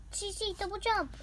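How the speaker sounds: excited move call. Pretend. Calls move.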